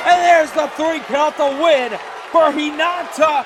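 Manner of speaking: high, loud triumphant announcing; victory call. Real, not acted. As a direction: roar out the win. Announcing the winners.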